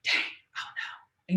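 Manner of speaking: slow, breathless whispered oh no; whispered dread. Retold or imitated. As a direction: whisper your dismay slowly. Dreading the principal's summons.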